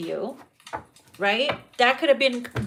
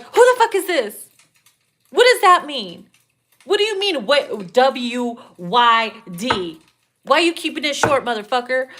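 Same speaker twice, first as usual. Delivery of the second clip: raised, agitated mock interrogation; jumpy, no chill; 5 dB louder than usual. Jumpy texter demanding answers from a partner, agitated. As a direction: fire loud questions, overreacting to a text. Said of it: "Doesn't know how to relax and always jumpy".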